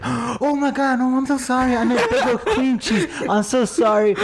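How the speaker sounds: high, flustered apology with a laugh; embarrassed gasp. Retold or imitated. Friend imitating the girl's high flustered apology. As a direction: squeal out a mortified sorry.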